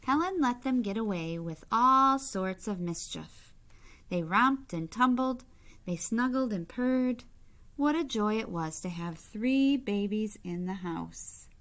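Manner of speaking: bright, lively storybook reading; reading to children. A narrator's reading. Reading a picture book aloud to kids, lively storybook voice. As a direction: read it out to the little ones with gentle delight.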